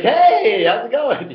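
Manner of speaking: fake-friendly hello. Twin pretends to recognize a stranger, overly cheerful. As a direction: overdo the friendly hello to cover not knowing them. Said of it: "But I'll be like".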